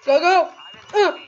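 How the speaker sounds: loud urgent urging; urgent shout. Real, not acted. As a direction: push it out, hurry hurry. Urgent urging during escape.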